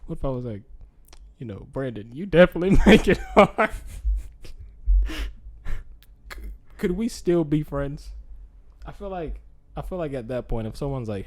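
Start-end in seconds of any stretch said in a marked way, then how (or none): 1.7-8.1 loud, laughing mock confession. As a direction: blurt it out laughing.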